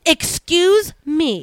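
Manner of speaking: slow, drawn-out offended "excuse me". Real, not acted. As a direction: stretch it out, affronted. Wife offended by husband's timing.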